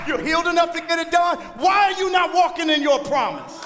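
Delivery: loud, high, challenging sermon; full-force preaching. Acted. Preacher asking why they stall short of their promise, full-force preaching. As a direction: drive each line at the congregation.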